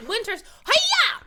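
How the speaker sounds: huge high "hi-ya" yell, slowed; mock ninja battle cry on "Winter's, hi-ya!"; karate shout. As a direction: shout hi-ya like a martial-arts kick. Joking karate shout on title.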